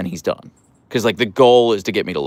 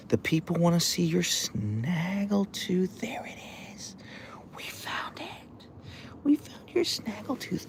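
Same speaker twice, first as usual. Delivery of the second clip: whisper; 8 semitones higher, 12 dB quieter than usual. Real, not acted. Owner whispers to dog about his snaggletooth. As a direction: hush it close to the dog.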